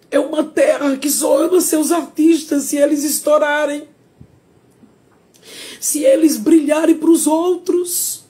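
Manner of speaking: real bitter protest shouted at the audience; indignant outcry. Real, not acted. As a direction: cry out the grievance. Outburst about Sergipe's artists.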